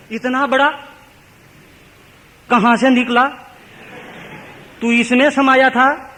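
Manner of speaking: high-pitched, wonderstruck questions; awed disbelief, voice lifted right up; acted astonishment. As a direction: marvel aloud in short, separated phrases. Dhana beholds God appearing from stone.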